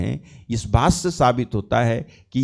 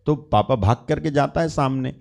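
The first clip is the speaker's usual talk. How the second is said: usual speaking voice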